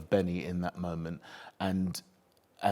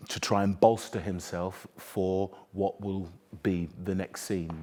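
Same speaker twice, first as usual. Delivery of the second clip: normal talking voice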